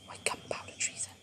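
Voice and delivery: soft unvoiced cue, barely audible; hushed prompting so only she hears; whispered prompt. Real, not acted. Mum whispering poem prompts to child.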